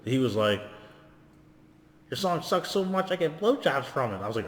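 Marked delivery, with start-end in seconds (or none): 2.1-4.2 imitating a rapper's high-pitched voice. Quoting the rapper whose voice threw him off, high mock voice. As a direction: pitch it way up, the opposite of booming. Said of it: "had that big booming voice. He was like".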